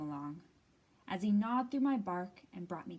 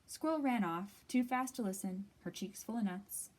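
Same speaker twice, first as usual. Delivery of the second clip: plain reading voice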